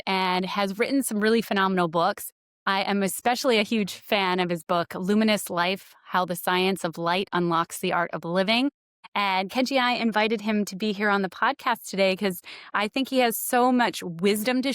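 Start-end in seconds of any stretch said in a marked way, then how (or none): none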